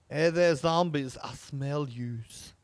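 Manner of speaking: slow, put-on Southern drawl, mock-teasing; lazy Southern drawl, hamming it up; Southern accent. Streamer taunting game zombies, slow mock Southern accent. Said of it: "I have to go southern with that".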